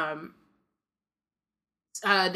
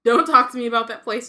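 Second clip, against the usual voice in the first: a fierce, louder read line; 5 semitones higher, 11 dB louder than usual. A narrator's reading. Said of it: "said Harry fiercely".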